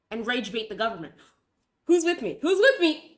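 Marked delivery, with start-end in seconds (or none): 1.9-3.2 louder and higher, mock rally cry; rallying call. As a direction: rouse the crowd half-jokingly. Rallying the viewers.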